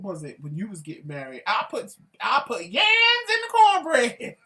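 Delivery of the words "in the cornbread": high, loud yell dissolving into laughter; shrieking laugh. Real, not acted. Wife confesses yams in the cornbread, shrieking with laughter. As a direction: shriek the confession then crack up.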